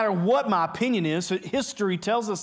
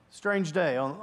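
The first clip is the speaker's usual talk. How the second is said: ordinary delivery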